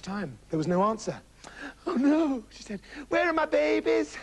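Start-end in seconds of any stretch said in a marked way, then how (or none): 1.9-2.4 high worried cry; high, acted alarm; alarmed mother. Mother finds children gone. 3.1-4.2 high mock wail; high, hammed-up motherly panic; distraught mother. Mother finds children gone.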